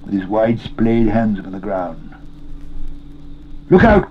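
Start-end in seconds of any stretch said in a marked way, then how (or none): 3.7-4.1 high, loud alarm; sudden raised shout; cried warning. A narrator's reading. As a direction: cry out an urgent warning. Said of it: "cried Sam".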